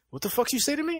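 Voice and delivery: high-pitched movie-character voice, rising at the end; mock affronted. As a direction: squeak out an offended challenge.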